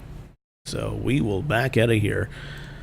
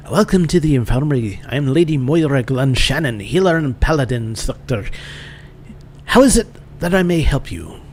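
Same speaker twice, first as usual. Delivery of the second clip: lilting, badly faked Irish; bad Irish accent. Paladin instructor welcoming a newcomer, bad Irish accent. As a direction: do a terrible Irish brogue. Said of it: "It's the worst Irish accent ever".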